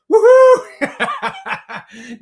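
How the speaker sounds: loud whoop breaking into laughter; woohoo with a laugh. Real, not acted. Host welcoming a viewer, laughing whoop. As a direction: cheer and laugh at once. Cheering Antoinette.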